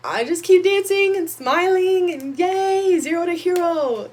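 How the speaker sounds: high, loud and slow fake cheer; hammed-up stage happiness; mock cheerful. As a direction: beam through it like nothing is wrong.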